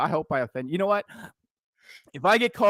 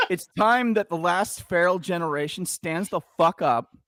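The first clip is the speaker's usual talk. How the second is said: ordinary delivery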